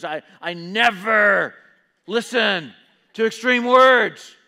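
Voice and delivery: slow, emphatic mock shout; bold outburst. Preacher acting out a listener refusing extreme words, boldly shouted. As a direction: blurt it out loud, drawing out each word. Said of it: "that's kind of a bold response".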